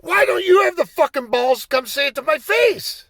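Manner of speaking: high, loud furious screaming; furious yelling. Retold or imitated. Player screaming abuse at the referee from the bench, furious. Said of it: "I'm like".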